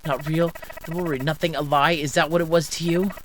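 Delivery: bitter, shouting rebuke; wounded fury. Acted. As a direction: throw the words back angrily. Betrayed partner throws words back.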